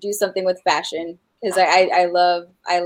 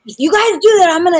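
higher, louder warning voice; retelling a mom's threat to her kids, higher and louder; mock threat to kids; 10 semitones higher, 9 dB louder than usual. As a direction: play the strict mom raising her voice.

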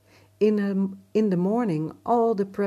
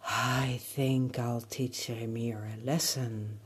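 ominous sky voice; 5 semitones lower than usual. Acted. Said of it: "the sky said".

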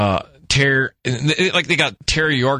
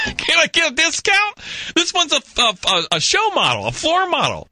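high mock protest; 13 semitones higher than usual. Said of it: "And I'm like".

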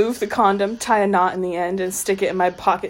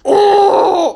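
high loud mock roar cry; 13 semitones higher, 10 dB louder than usual. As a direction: belt out a silly roar. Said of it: "The roar".

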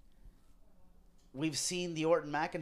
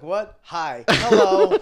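mock surprise; 5 semitones higher, 16 dB louder, much slower than usual. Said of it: "I look".

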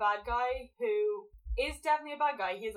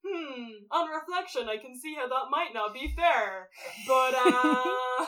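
mock reflective; 3 semitones higher, 7 dB louder than usual. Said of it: "he's like".

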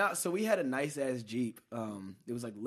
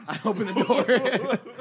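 high, loud, drawn-out words through laughter; real laughing confession; 8 semitones higher, 10 dB louder, much slower than usual. Real, not acted. Storyteller admitting to friends he opened up, laughing hard. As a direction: burst out laughing while admitting it.

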